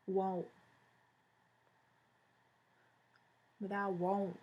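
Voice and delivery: drawled southern US accent on want. Shown as a demonstration. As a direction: lean into a Southern twang. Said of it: "It's my southern accent".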